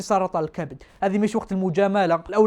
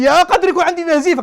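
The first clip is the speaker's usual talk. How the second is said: near-tears panic; 8 semitones higher, 6 dB louder than usual. Retold or imitated. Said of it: "بان بكي".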